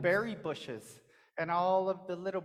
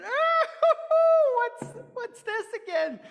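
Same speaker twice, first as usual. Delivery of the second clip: much higher, loud laughing cry; shocked laugh; 8 dB louder than usual. Acted. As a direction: laugh in alarm. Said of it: "and he went".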